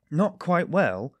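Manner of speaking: high, loud, drawn-out disbelief; indignant slow exclamation; incredulous echo. A narrator's reading. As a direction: throw it back slowly and loudly, aghast. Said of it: "Razumikin caught him up".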